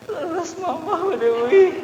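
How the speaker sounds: high, drawn-out mock-tearful voice; put-on wife's voice. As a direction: squeak it slowly, hammed-up. Acts wife hearing guests will leave.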